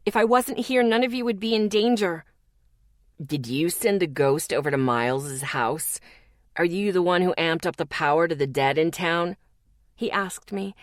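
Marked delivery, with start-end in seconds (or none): 3.2-9.4 low, gentle questioning; soft and understanding. Acted. Zeke gently questions Lexi, low and understanding. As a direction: lead her kindly to the answer. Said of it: "His voice was soft and full of understanding".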